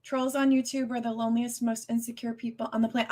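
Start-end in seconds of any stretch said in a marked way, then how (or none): none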